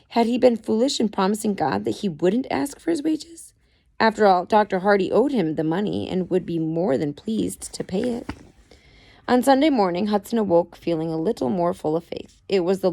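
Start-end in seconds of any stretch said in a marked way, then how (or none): none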